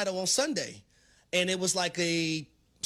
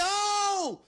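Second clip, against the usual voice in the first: high startled oh; retold yelp; 11 semitones higher than usual. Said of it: "I said".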